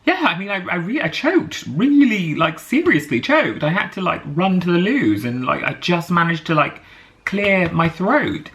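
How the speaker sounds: high, breathless rush of alarm; frantic retelling. Retold or imitated. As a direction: insist urgently that you really choked. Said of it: "And I was just like".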